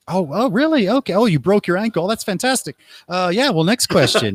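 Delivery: high, overly chirpy mock interest; fake interest. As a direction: pretend to listen, bright and hollow. Fake interest, can't hear her.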